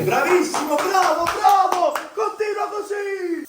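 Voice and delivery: high, slow shouted cheers; cheering shout. Real, not acted. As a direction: yell encouragement across the room. Cheering on nervous patient.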